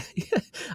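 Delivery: breathy giggle. Real, not acted. Laughing while telling story.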